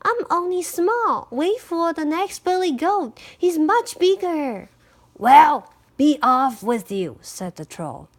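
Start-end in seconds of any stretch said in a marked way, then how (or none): 0.0-4.7 high, small goat voice; very little voice. A narrator's reading. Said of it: "in a very little voice". 5.2-7.2 loud, brusque troll dismissal; gruff troll. A narrator's reading.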